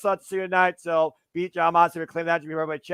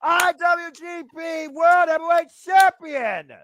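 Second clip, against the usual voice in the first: high, shouted title-win call; wrestling commentator; 12 semitones higher, 7 dB louder, slower than usual. Real, not acted. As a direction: crown the new champion at full voice. Live play-by-play of title win.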